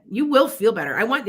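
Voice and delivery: ordinary talk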